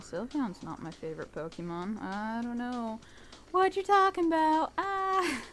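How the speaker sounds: ordinary talk, nothing marked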